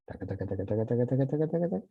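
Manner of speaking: walk the taca pattern upward in pitch; rising. Shown as a demonstration. Teacher demonstrating a rising line, taca chanted upward.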